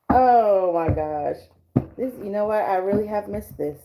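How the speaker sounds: normal talking voice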